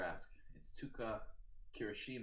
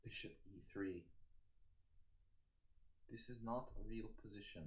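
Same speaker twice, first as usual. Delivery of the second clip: lightly voiced mild-mannered Eastern European-British accent; a mild Eastern European and British accent, barely put on; Pavel Nefrudov imitation; 6 dB quieter than usual. Streamer introduces himself as the chess player in a light, mild Eastern European-British accent. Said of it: "That was the best I could do for Nefrudov imitation".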